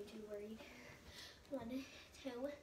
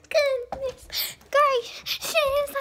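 cringy exclamation; 13 semitones higher, 9 dB louder, much slower than usual. Pretend. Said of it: "being cringy".

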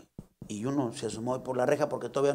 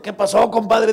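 compadre voice; 6 semitones higher, 7 dB louder, slower than usual. Acted.

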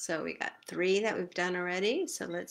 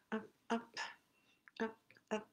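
ordinary talk, nothing marked

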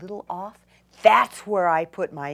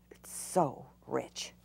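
slow, lower, hushed admiration; admiring whisper; 6 semitones lower, 10 dB quieter, slower than usual. Real, not acted.

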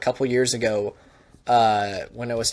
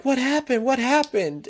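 much higher, frantic repeated question; a frightened mom rushing up; panicked mother; 11 semitones higher than usual. Retold or imitated. Mother who missed the flight asking staff, panicked.

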